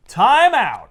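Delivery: loud, high referee-style call; call for time out. Retold or imitated. Spider-Man halting the whole fight, loud. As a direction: bellow for everyone to freeze. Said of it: "and he goes".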